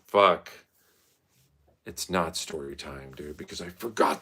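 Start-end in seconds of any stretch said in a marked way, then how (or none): none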